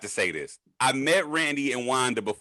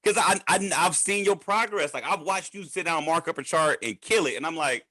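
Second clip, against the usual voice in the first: usual speaking voice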